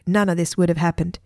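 ordinary delivery, nothing marked

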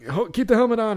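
high, playful mock shout; teammates yelling. Teammates joking after she scores, high mock cheer.

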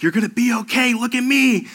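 high, urgent reassuring buddy; imitating buddy. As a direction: voice the buddy pleading him to hold on. Buddy reassuring wounded narrator.

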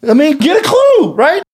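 high, hammed-up yell at the crowd; mock yell. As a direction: play it like a fed-up guy yelling them off. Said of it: "I'm gonna yell at the crowd".

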